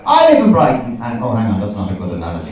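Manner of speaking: ordinary talk, nothing marked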